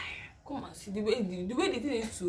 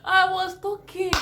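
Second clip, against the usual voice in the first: loud protest; 10 semitones higher, 7 dB louder, slower than usual. Retold or imitated. Insisting she was just talking.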